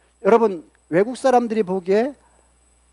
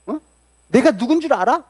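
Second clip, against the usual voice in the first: higher-pitched mock outrage; acted indignant anger; 5 semitones higher than usual. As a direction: act out someone angrily pulling rank. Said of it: "화를 내면서 뭐라 그러냐면요".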